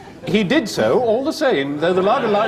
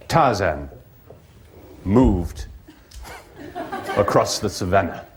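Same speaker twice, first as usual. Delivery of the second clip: story narration; 8 semitones lower than usual. A narrator's reading.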